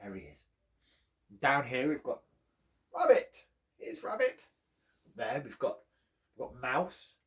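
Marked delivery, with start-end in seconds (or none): 2.9-4.5 higher, bright greeting; excited introduction. Acted. Storyteller pointing out Rabbit to children, higher.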